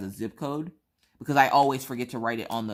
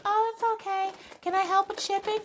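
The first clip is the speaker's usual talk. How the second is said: exaggerated tiny, very high sweet voice; Rachel. Friend sweetly offering to help with shipping, hammed-up high impression. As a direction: squeak it in a mock falsetto. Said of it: "she don't talk like that, obviously".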